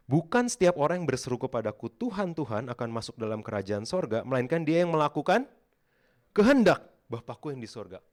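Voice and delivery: loud, high proclaiming of the verse; preaching scripture. Acted. Preacher proclaims Jesus' words to the church, loud and high. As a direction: thunder the verse out to the congregation. Recites Matthew 7:21 at full force.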